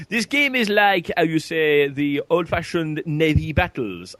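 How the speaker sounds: hammed-up French accent, slowing at the end; thick mock French accent. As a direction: imitate a French developer explaining his game. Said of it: "He was the Frenchest French guy".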